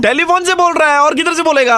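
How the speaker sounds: angry shout. Acted. Prank caller shouting at the woman for keeping everyone waiting. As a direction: bellow at her for not turning up, pitched high.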